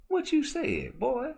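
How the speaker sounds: imitated woman's voice, very high, mock stern; Miss Parker scolding. Retelling the skit, Miss Parker snapping at the boy in a high voice. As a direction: squeak it up high like the offended teacher.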